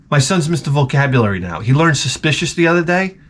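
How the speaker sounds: ordinary talk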